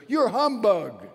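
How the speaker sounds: Dorothy leaning slowly on the word "humbug"; stress on humbug. Retold or imitated. Preacher retelling Dorothy's accusation, a slow punch on "humbug". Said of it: "That was the word in the story".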